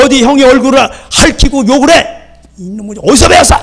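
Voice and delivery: high-pitched parental telling-off, acted; angry scolding. Parent to child who hit older brother, angry scolding. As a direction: play an outraged parent laying into a child. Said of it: "그냥 막 이렇게 야단을 친단".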